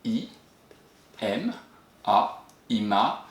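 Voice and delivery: each letter named slowly, then the syllable "ima" joined; spelled out slowly. Shown as a demonstration.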